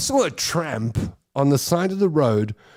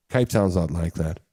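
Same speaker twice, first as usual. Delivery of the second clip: usual speaking voice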